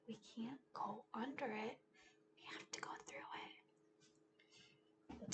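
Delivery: mock-scared whisper; spooky whisper. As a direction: hush the kids as if something lurks. Scary dark forest.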